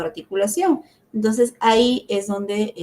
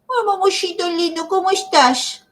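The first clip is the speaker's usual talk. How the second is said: put-on much higher, distorted baby-talk voice; 11 semitones higher than usual. Shown as a demonstration. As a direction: coo at the child in babyish sounds. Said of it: "O sea, no". (habla de bebé muy aguda y distorsionada)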